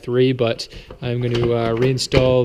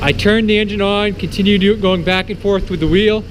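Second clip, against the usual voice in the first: raised voice over a running engine; shouting over engine; 4 semitones higher, 5 dB louder than usual. Real, not acted. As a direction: project loudly over the engine noise. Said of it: "hopefully you can hear me over the engine".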